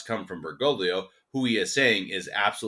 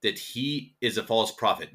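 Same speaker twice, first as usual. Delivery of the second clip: measured, deliberate reading pace, slightly raised pitch; slow reading; 4 semitones higher, slower than usual. Real, not acted. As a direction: read the quotation out slowly. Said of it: "I'm gonna read it slowly".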